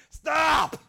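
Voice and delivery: sudden loud high bark of stop; yelled stop. Retold or imitated. Fed-up dad yelling at the drumming kid, loud shout. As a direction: yell it like a fed-up parent.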